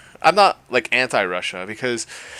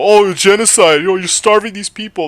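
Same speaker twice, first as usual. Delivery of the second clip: mocking quote; 8 semitones higher, 7 dB louder than usual.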